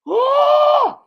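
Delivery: loud, very high mock scream; sudden piercing imitated shriek of pain. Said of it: "just like that".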